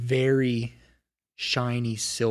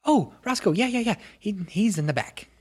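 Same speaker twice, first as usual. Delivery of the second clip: much higher, cheerful little gnome voice; chirpy gnome; 10 semitones higher, 3 dB louder than usual. Acted. Gnome apprentice greeting the visitor, high and chirpy. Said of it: "She's like".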